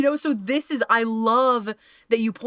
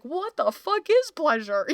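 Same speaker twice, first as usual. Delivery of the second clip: exasperated question; 9 semitones higher than usual. Retold or imitated. Exasperated quoted inner voice.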